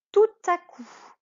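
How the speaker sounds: "Tout à coup" with the t sounded before "à", high and loud; t liaison. Shown as a demonstration. Teacher models "Tout à coup" loudly for pupils. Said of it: "On ne dit pas tout à coup".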